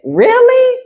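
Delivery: shocked squeak of disbelief; mock disbelief. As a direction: act scandalized by it.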